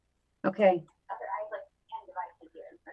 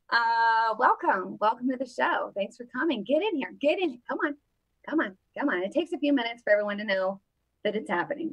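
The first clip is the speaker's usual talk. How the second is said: ordinary talk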